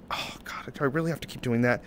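fast, soft, whispered grumble; weary whisper. Real, not acted. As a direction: mutter it under your breath.

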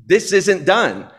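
mild mock scolding of a child; stern parent. Parent checking the kid's bed, mildly mock stern.

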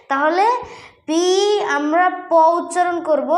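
ordinary talk

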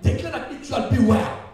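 loud, high prophetic shouting; full-force preaching. Real, not acted. Preacher prophesies new politicians for the country, shouted preaching. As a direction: proclaim it in rousing, repeated waves.

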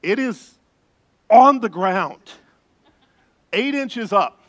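loud, high, slow disbelief; emphatic reveal. Real, not acted. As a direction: punch out each phrase with big pauses. Stresses how low the opening is.

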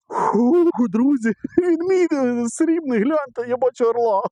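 very high, drawn-out squeal of joy; real excited shouting. Real, not acted. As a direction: squeal it out, overwhelmed by the find. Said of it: "Покричав тільки що".

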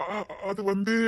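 soft, faltering start; stall, softly fumbling for words; hesitant stammer. Acted. Son caught out after his shouting, hesitant.

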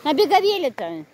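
high carrying call. Real, not acted. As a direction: call across the beach. Calling to child outdoors.

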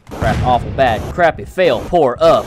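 frantic play-by-play. Real, not acted. Gamer rating their run as it unfolds, frantic. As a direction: rattle off verdicts with rising panic.

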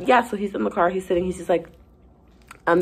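normal talking voice

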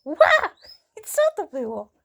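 sudden loud high yelp; startled. Real, not acted. Owner startled by a sudden noise, loud. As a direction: jump at the noise.

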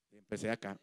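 usual speaking voice

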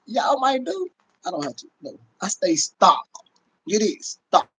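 usual speaking voice

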